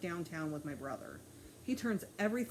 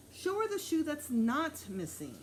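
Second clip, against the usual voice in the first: losing patience; 8 semitones higher, 3 dB louder than usual. A narrator's reading. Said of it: "definitely losing his patience".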